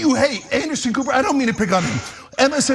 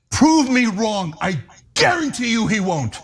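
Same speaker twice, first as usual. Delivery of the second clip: ordinary delivery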